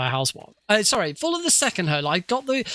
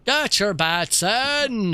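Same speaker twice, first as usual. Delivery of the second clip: slow, drawn-out Cornish twang on the call; mock Cornish accent; 4 semitones higher, much slower than usual. Gamer calls for bets in a hammed-up Cornish accent. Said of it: "A Cornish accent".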